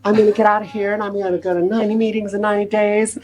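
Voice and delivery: high, fast, sing-song mockery of a patient's big promises; parroting a fellow patient's vows in a high, fast mock voice; mock eager patient. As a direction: rattle off their pledges like a know-it-all newcomer. Mocking fellow patients' treatment promises.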